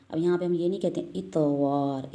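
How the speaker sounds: ordinary talk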